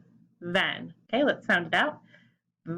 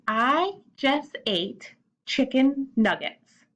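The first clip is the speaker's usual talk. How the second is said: slow model sentence; 4 semitones higher, slower than usual. Shown as a demonstration. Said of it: "a sentence for ate".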